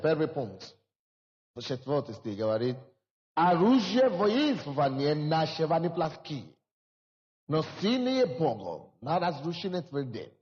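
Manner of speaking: normal talking voice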